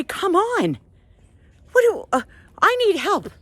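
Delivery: high-pitched mock yell, no real volume; retold screaming. Lost and calling for help, retold screaming. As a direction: plead high as if hollering. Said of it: "screaming at the top of my lungs".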